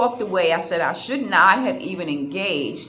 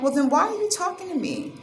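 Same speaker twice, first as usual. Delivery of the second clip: incredulous retort; 10 semitones higher, faster than usual. Retold or imitated. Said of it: "And I'm like".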